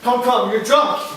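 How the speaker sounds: loud, high pleading call; urgent shout. Acted. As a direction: shout to hold him back. Montano restrains Cassio.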